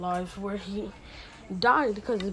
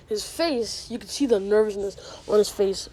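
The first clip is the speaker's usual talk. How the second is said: ordinary talk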